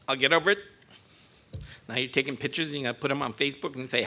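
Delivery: ordinary talk, nothing marked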